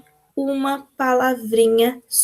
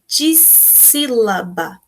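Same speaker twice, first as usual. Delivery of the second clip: slow, each syllable pulled apart; much slower than usual. Shown as a demonstration. Teacher to pupils, spelling out syllables slowly. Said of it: "como é que a gente chama?".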